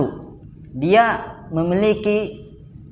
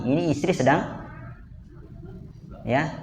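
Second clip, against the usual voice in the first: ordinary talk, nothing marked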